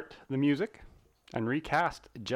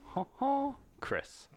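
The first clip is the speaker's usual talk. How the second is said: bad French accent; 12 semitones higher, slower than usual. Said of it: "in a terrible French accent".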